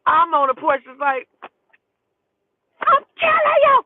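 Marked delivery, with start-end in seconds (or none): 2.8-3.8 very high, loud screamed threat; screaming. Retold or imitated. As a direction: scream it at someone down the street. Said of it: "I'm screaming".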